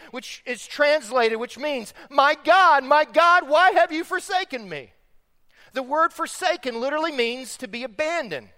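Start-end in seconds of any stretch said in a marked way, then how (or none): 2.1-4.9 high, loud plea to God; preacher voicing a loud cry from the cross; crying out loudly. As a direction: cry it out as a forsaken appeal. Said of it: "cried out in a loud voice".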